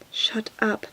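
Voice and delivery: usual speaking voice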